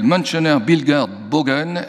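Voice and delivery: "Münchener Bildger Bogen" in an admittedly clumsy German accent. Shown as a demonstration. Lecturer naming a German magazine to his audience, in a clumsy German accent. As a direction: try the German title in a poor, self-mocking German accent. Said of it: "mon accent allemand qui évidemment est assez nul".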